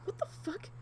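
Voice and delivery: high-pitched startled cry; jolted by the thud, blurting it out; startled shout. Retold or imitated. Woman startled by her cat hitting the door, high shocked cry.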